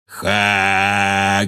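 drawn-out monster call; giant's roar. A narrator's reading. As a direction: hold the word like a roaring giant. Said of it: "и проревел".

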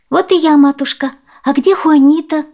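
put-on high, loud girlish voice; high-pitched child's greeting; little girl. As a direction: arrive home and ask as a young girl. Girl's voice in a story.

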